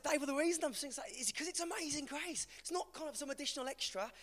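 high-pitched, animated pleading; earnest protest. Retold or imitated. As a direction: plead your case to a mate. Explaining his loud singing to friend.

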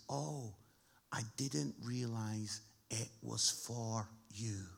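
low, soft, slow rebuke; pointed retort. Acted. One man answering a worship complainer, pointed retort. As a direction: let the quiet dig land slowly.

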